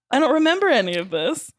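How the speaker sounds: normal talking voice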